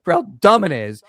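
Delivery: normal talking voice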